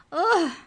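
very high, loud squeaky "Ugh"; squashed grunt. Acted. The book elf grunts as Trent slams the covers. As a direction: shut in the book hard. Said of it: "a voice went".

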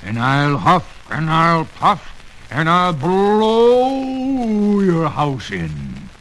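slow, loud wolf threat; wolf threatening the pig's house; big bad wolf. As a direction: huff it out like a menacing wolf.

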